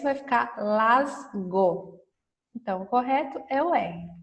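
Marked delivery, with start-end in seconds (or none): 0.0-2.0 slow and clear. Shown as a demonstration. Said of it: "E se eu colocar S".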